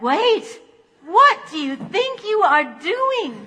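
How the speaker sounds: high, loud, slow alarmed protest; a startled donut crying out, slow and loud. Acted. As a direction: cry out in shock as the mouth opens. Donut about to be eaten.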